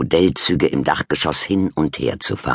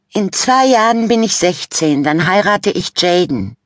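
put-on high, loud teen-girl announcement; announcing Fabienne; 5 dB louder than usual. A narrator's reading. Teen sister announcing her wedding plans at dinner, high and loud. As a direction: proclaim it like a dreamy teenage girl. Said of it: "verkündete Fabienne".

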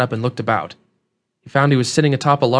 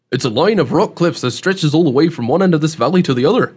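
folksy country dialect, swinging emphasis; rustic old man, explaining; 3 semitones higher, 3 dB louder than usual. Acted. Host explains the rock cliffs to newcomer, rustic voice. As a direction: explain it like a seasoned backwoods local.

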